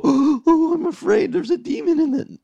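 high, loud hammed-up fright with a gasp; mock panic. Pastor mocking a panicked Jesus to the church. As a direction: play a silly panicked Jesus. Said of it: "Jesus doesn't go".

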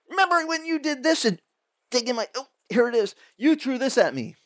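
put-on high, gleeful taunt pulling out an old grudge; mock accusing gloat. The grudge-holder throwing an old rock back, gloating. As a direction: wave the old hurt in their face.

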